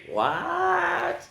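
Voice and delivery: high, soft, stretched squeak of disbelief; mock disbelief. Reader shocked by robot line, comic disbelief. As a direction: squeak it quietly, drawn out.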